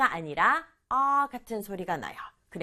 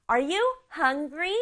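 "hungry" drawn out with a questioning lift; slow teaching rhythm, weight on "hungry"; stress on hungry; 5 semitones higher, much slower than usual. Shown as a demonstration. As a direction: lean on "hungry" as the key word. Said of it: "여기에 강조 주는 거예요".

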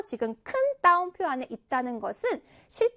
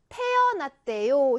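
태어났대요 slowly, syllable by syllable; 또박또박; slower than usual. Shown as a demonstration. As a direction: read out 태어났대요 slow and distinct. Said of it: "이 부분을 어떻게 읽어라? 또박또박". ("태어났대요"를 한 자씩 천천히 또박또박)

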